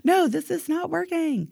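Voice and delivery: ordinary talk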